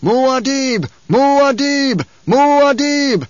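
high, drawn-out rallying cry; high-pitched battle chant, each cry held long. A narrator's reading. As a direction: chant the name as a ringing war cry. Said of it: "his battle cry was lifted to echo".

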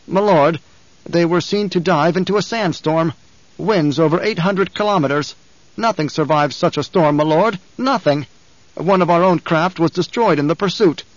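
a frightened underling hurrying to please; anxious guard captain. Acted. Captain voiced through long report.

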